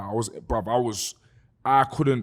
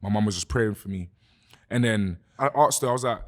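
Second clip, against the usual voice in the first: ordinary talk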